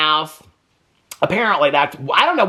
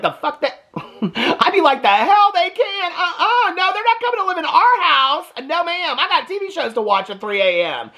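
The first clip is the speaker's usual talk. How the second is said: high, indignant imagined wife refusing, half laughing; a hammed-up high-pitched refusal, put your foot down; imagined wife refusing to share the house, mock outraged and high; mock outrage; 8 semitones higher than usual.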